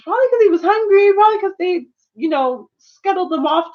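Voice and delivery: heated defense. Real, not acted. Defending the hungry boy.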